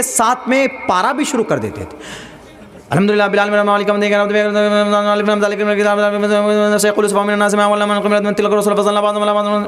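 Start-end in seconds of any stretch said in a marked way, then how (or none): 2.9-9.6 breathless gabble on one note, words blurred together; mock rushed recitation. Imitating the hafiz's six paras an hour, garbled rapid chant. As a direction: recite too fast to be understood. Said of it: "ان کو خود ہی سمجھ میں نہیں آ رہا".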